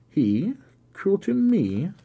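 high, loud, half-smiling disbelief; as if the idea is absurd; incredulous cry. A narrator's reading. Bertha refusing to believe her father could be cruel, incredulous cry. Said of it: "cried Bertha with a smile of incredulity".

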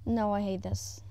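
ordinary delivery

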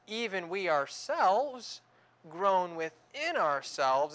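slow, weighty preaching of the verse; emphatic scripture reading. Acted. Preacher reading Romans aloud to the congregation, slow and weighty. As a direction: lean into every word.

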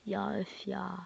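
hushed disappointed sigh; whispered disappointment; whispered groan. Real, not acted. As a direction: breathe out the letdown. Disappointed at card.

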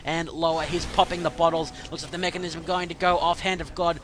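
fast, loud live fight calling; excited play-by-play. Real, not acted. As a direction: call the fight as it happens.

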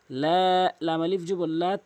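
usual speaking voice

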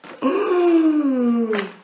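dramatic shocked gasp; loud high gasp. Acted. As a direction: gasp in horror. Elephant dropping on Alfie.

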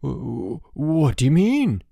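nervous stammer rising in pitch. A narrator's reading. George turned pale at his roommate's alarm, stammering. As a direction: stumble on the first word, then rise anxiously. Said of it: "he stammered".

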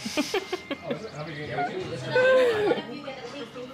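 a long, high burst of real laughter; giggling laugh that trails off. Real, not acted. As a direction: laugh freely at something funny. Laughing at the winery.

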